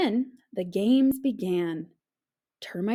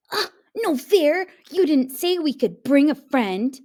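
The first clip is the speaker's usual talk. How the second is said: whiny complaint; 6 semitones higher, 7 dB louder than usual. A narrator's reading. Tim complains.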